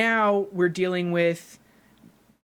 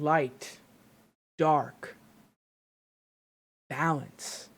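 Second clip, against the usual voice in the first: spaced-out single words, trailing softer; Rey; 3 semitones lower, 4 dB quieter, much slower than usual. Retold or imitated. Fan quoting the heroine's voice from the trailer, quiet and measured. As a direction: lightly imitate a trailer voice-over, pausing between words. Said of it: "you hear Rey's voice saying".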